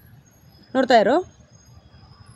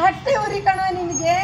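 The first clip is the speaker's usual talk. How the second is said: taunting line demo. Shown as a demonstration. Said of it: "ಜೋರು, ಜೋರು".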